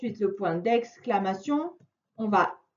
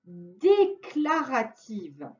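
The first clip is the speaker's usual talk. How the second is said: "déclarative" stretched out syllable by syllable, falling; teacher stretching "déclarative" so it sticks; slow, drawn-out term; much slower than usual. Shown as a demonstration. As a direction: spell out "déclarative" slowly for a child. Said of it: "on appelle ça une phrase".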